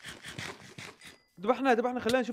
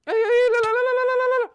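panicked no; 12 semitones higher, 8 dB louder than usual. Real, not acted. Giant spider attacks.

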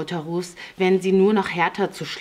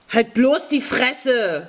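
higher, drawn-out angry order; angry snapping; 6 semitones higher, 3 dB louder, slower than usual. A narrator's reading. Fjodor silences the talkative boy, angry snapping. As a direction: shut the kid up fiercely. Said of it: "fuhr ihn Fjodor an".

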